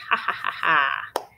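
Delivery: mock evil laugh, lightly done; evil genius laugh. Benny answering his mom with a mock evil laugh. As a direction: cackle like a cartoon villain. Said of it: "laughed like an evil genius".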